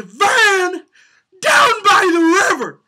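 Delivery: high, loud, slow wailing "down by the river"; cracking shout. Shown as a demonstration. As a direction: stretch it into a cracking yell. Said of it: "the inflection on van down by the river".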